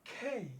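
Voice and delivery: খেই with a puff after k; aspirated K. Shown as a demonstration. Teacher applying the rule for the class, aspirated K. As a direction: model খেই, aspirated and gliding to i. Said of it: "এখানে কি উচ্চারণ করব".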